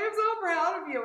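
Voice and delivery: high, loud imitation of sobbing pride; retold sobbing. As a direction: imitate a proud mom wailing.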